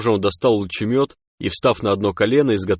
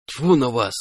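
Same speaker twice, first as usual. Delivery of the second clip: mildly angry, dismissive outburst; angered; 3 semitones higher than usual. A narrator's reading. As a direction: spit it out in annoyance. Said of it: "разозлился сталкер". (сердито, с досадой)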